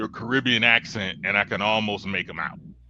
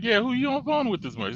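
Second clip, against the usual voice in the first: quoted question; 14 semitones higher, faster than usual. Retold or imitated. Quoting himself asking girlfriend's mom.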